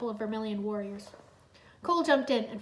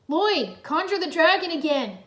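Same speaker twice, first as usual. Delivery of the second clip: loud high call across the sky; 6 semitones higher, 9 dB louder than usual. A narrator's reading. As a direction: call out to him urgently. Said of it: "Cole called".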